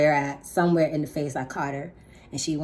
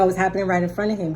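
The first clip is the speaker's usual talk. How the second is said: ordinary delivery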